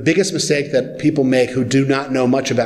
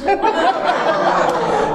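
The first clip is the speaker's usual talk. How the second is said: high laugh. Real, not acted. Audience laughs at kid's question.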